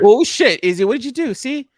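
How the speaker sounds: loud high burst of startled teasing; high loud mock shock; mock alarm. Real, not acted. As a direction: jump on it like something just went wrong.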